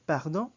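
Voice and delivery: loud "Pardon" ending in a short NG; French "Pardon" with a clipped nasal final vowel, rising. Shown as a demonstration. Teacher demonstrates the final N of "Pardon" as a short NG. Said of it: "almost like the N G of the Filipino".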